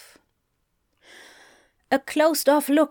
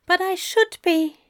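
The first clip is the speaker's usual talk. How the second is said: higher-pitched, frustrated protest; 9 semitones higher than usual. A narrator's reading. Aziraphale protesting to Crowley, frustration lacing his words. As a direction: start to object, voice pitched up. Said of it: "frustration lacing his words".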